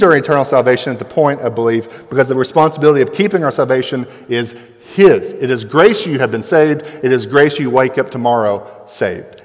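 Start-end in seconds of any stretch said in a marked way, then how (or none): none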